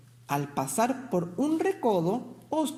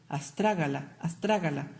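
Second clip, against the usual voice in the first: savoring the name; 5 semitones lower than usual. Acted. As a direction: roll the name around lovingly. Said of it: "repitiendo su nombre con delicia". (repitiendo el nombre con delicia, bajito y lento)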